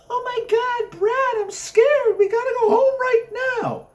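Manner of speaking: put-on high, breathless frightened girl's voice; scared girlfriend. A narrator's reading. Debbie pleading with Brad to drive home, high and frightened. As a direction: squeal it like a terrified teen date.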